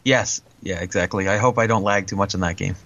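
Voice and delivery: normal talking voice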